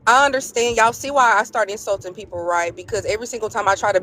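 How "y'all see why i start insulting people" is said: ordinary talk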